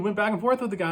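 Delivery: ordinary talk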